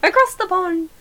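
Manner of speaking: attempted English accent. Shown as a demonstration. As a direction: have another go at a badly faked English accent. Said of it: "That's all I got".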